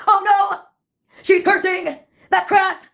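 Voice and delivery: put-on high-pitched theater-kid outburst; theatrical scream. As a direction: play it like a melodramatic musical chorus. Recurring theater-kid chorus.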